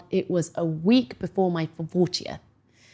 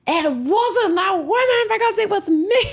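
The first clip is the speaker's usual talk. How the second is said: mock husband voice; 13 semitones higher, 4 dB louder than usual. Mimicking husband claiming credit.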